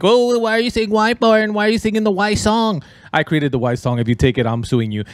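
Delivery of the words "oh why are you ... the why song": high mock viewer voice; imitating a viewer. Gamer voices a viewer teasing his why song. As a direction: squeak it like a teasing fan. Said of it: "you're telling me, like".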